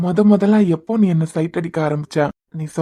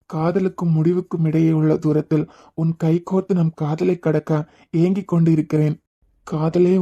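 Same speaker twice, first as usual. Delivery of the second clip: poem recital. Acted. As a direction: read it like a tender love letter. Reads a love poem aloud.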